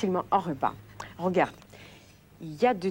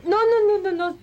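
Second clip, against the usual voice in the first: sharp, much higher and louder alarmed nos; urgent no; 14 semitones higher, 8 dB louder than usual. Real, not acted. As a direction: stop them before they drink it. Stopping guest drinking vinegar. (arrêter l'invité qui va boire le vinaigre, non affolé et fort)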